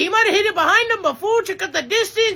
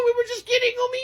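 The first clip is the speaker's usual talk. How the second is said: ordinary delivery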